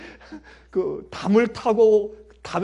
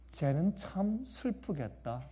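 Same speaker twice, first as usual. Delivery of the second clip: softer, slow, tender pity; soft mother; 10 dB quieter, slower than usual. Retold or imitated. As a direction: sigh it gently for the other kid. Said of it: "이렇게 얘기하시는 건".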